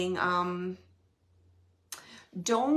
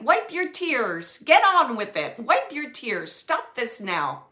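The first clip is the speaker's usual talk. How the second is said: firm urging; 3 semitones higher, 7 dB louder, slower than usual. Retold or imitated. Spirit urging her on.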